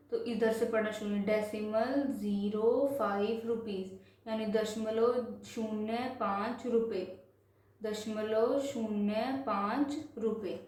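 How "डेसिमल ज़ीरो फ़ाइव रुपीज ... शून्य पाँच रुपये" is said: measured decimal reading, digit by digit; slow reading demonstration. Shown as a demonstration. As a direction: space out each word from the decimal point on. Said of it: "अब इसको पढ़ेंगे कैसे".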